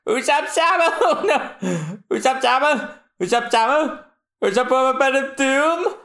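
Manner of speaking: high, squealing chants; high squealing cheer; high fangirl cheering. Acted. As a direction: cheer him on like a kid. Voicing fans cheering Usopp.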